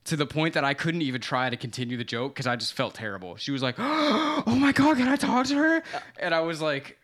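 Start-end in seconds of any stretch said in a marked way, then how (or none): 3.8-5.8 much higher, louder, slow gush; excited squeal. Retold or imitated. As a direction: squeal like a starstruck fan. Starstruck fan reacts.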